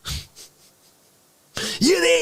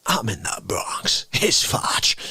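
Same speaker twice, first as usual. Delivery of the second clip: hushed caller; whispering down the phone; whispered phone voice; 10 semitones lower, slower than usual. Retold or imitated. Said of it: "you get a call".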